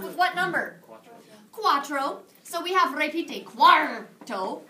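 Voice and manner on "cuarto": Cuarto with a loud pirate ar, ending in a rise. Shown as a demonstration. Teacher leading the class in a drill with a pirate ar. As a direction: growl the ar in cuarto. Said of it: "come on, little pirates".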